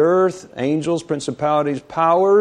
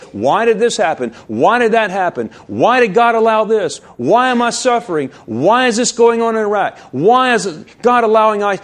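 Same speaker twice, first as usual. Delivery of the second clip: high-pitched volley of rhetorical questions; preacher's insistent questioning; 9 semitones higher, 5 dB louder than usual. Acted. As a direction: pile up each why, climbing in pitch. Voices a string of anguished questions.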